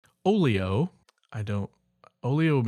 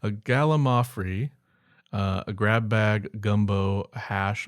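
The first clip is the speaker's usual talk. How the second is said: ordinary talk, nothing marked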